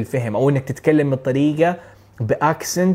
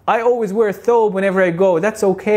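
higher, louder, slow confident answer; improvising exam answer; 7 semitones higher, 4 dB louder, much slower than usual. Retold or imitated. Test taker improvising an answer to the examiner, slow confident bluff. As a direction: bluff through it slowly and brightly. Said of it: "أقول له".

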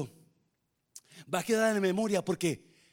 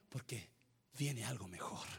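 much softer, low and intimate; hushed, tender preaching; 9 semitones lower, 17 dB quieter than usual. Real, not acted. Pastor softly telling the church why people cry, tender hush. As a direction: draw them in close and gentle.

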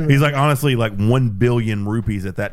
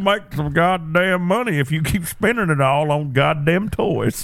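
put-on old man voice; 7 semitones higher than usual. Pawn shop old man bit.

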